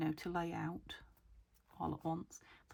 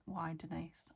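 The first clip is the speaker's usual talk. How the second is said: ordinary talk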